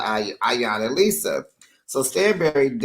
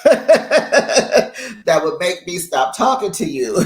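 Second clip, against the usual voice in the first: laughing through the line, a bit slower; 6 semitones higher, 6 dB louder, slower than usual. Retold or imitated. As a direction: giggle out the punchline. Quote dissolves into laughter.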